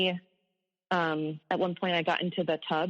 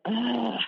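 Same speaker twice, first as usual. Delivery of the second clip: imitated long, higher-pitched groan; mock exasperated groan; 6 semitones higher than usual. Husband grumbling as wife gets up for crying baby, mock groan.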